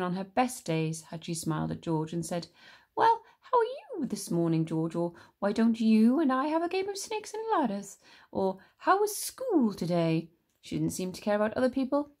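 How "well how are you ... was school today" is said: higher, sweetly mock-friendly old lady; imagine the nice grandma she never is; pretend kind grandma.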